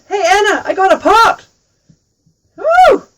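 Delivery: loud, high, delighted yell; excited shout. Acted. Tui calling to Anna on the way home, overjoyed and loud. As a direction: shout it out thrilled to bits.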